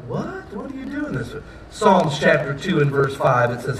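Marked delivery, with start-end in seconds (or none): 0.1-1.3 soft, high-pitched bewilderment; baffled reaction. Acted. People reacting to God's mocking laugh, baffled. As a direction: ask it small and high, taken aback.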